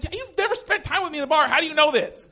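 very high-pitched mock outrage; indignant protest. Comic retells objecting to his friend, high indignant protest.

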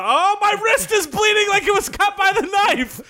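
high mock panic, shouted; hammy shriek. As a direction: shriek like a cartoon victim. Hammed bleeding outrage.

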